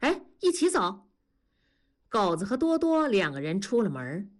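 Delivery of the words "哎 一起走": blurted out, much higher; a sudden high call to go along; sudden eager outburst. A narrator's reading. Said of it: "多多突然说".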